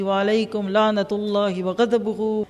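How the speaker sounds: ordinary delivery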